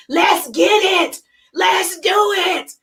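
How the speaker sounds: slow, drawn-out rallying cry; urging shout. Pretend. Coach wants to shake every single person awake, urging drawn-out shout. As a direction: call it out like you're shaking them into action. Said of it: "just shake 'em and like".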